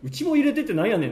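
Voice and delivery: mock Kansai dialect. Fan quotes the character asking to join, imitated Kansai dialect. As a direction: lightly put on fake Kansai dialect. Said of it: "偽関西弁。".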